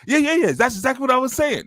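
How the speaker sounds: high-pitched, mocking eager agreement. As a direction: parrot them in a silly high voice. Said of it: "act like y'all was saying the same thing".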